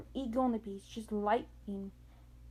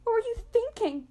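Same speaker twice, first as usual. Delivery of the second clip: much higher, louder panicked cry; panicked shout; 12 semitones higher, 8 dB louder than usual. A narrator's reading. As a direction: yell it in a frantic panic. Grover panics.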